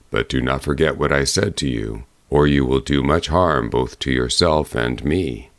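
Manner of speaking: low, grave bear voice; low bear warning. A narrator's reading. Bear warning the girl about her mother, low and grave.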